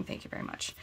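whispered aside. Real, not acted. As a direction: whisper it privately. Declining to share personal info.

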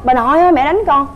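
high, loud cry for grandma; crying child call. Retold or imitated. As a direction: mimic the little girl wailing for grandma. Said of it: "cứ mỗi lần mà nghe nó khóc". (giả giọng bé khóc gọi bà nội)

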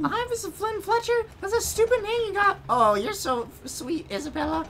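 put-on high squeaky kid voice; cartoon falsetto. Girl fumbling a greeting, high falsetto.

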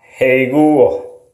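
usual speaking voice

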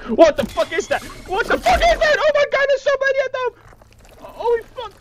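very high panicked squeal; freaking out at a sky-high pitch. Real, not acted. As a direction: squeal in sudden game fright, words tumbling out. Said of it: "I'm just panicking a lot".

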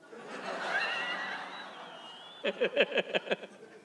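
long, airy laugh. Real, not acted. Preacher amused by parishioner's distrust, long laugh. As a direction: crack up after your own punchline.

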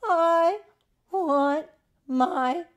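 high, drawn-out cry, word by word; loud wail. A narrator's reading. All crying out for mummy together, loud high wail. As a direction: call out loud with a pause between words.

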